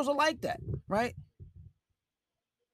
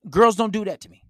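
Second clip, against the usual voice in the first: emphatic repeat; 8 dB louder than usual. Real, not acted. To the viewers, repeating his rule louder and emphatically. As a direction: say it again, louder and firmer.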